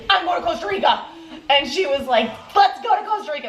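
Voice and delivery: high, laughing eager voice; a burst of playful excitement; excited quote. Retold or imitated. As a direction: blurt it out, thrilled. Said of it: "I was like".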